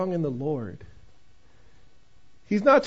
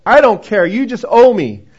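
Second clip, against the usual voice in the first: loud, higher, cold refusal; dismissive landlord; 4 semitones higher, 10 dB louder than usual. Retold or imitated. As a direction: brush her off and demand it.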